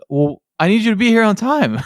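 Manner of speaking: incredulous, stating the obvious; the boss retelling his own exasperated comeback, pitched high; exasperated retort. Retold or imitated. Boss's exasperated reply, retold.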